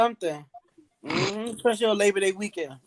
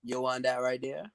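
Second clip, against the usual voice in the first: put-on lazy Jamaican accent; 5 semitones lower, 8 dB quieter than usual. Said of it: "Jamaicans take their time and talk".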